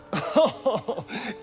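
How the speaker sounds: high burst of laughter; dismayed laugh. Acted. Companion reacting to a spoiled snack, high incredulous laugh.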